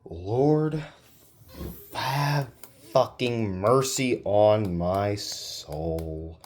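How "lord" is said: ordinary talk, nothing marked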